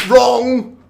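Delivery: huge high drawn-out shout; mock-angry shout. Host telling a guest the answer is wrong, mock-angry shout. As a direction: bellow it like a quiz judge. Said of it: "you really shouted at us".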